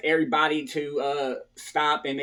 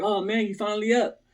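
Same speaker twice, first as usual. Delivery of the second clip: roommate; 5 semitones higher than usual. Retold or imitated. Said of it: "he's like".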